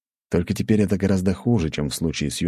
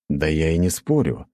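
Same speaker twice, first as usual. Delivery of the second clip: ordinary delivery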